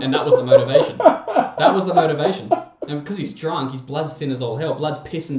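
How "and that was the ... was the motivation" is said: laughing through the words, high and loud. Real, not acted. Telling mates the tattoo punchline, laughing hard. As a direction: crack up while repeating the punchline.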